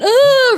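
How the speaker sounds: high loud panicked wail; wailing cry. Acted. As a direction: wail while running away. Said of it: "arms wobbling and wailing, going".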